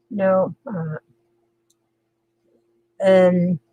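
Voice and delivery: normal talking voice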